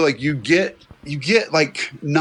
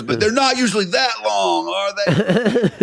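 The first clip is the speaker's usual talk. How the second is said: pleading patient; 6 semitones higher, slower than usual. Retold or imitated. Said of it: "And he goes".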